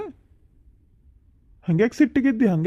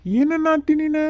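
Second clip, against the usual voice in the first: startled; 9 semitones higher, 4 dB louder than usual. Acted. Husband startled by wife's anger.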